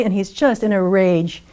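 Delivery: ordinary delivery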